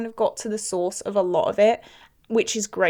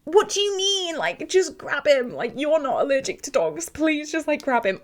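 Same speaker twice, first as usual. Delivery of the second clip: high, exasperated plea; high, frustrated incredulity; 5 semitones higher than usual. Real, not acted. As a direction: berate the couple in disbelief. Arguing with the absent couple.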